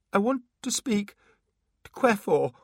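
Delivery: high, shaking timid plea; trembling voice. Acted. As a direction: ask fearfully, voice shaking. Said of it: "in a trembling voice".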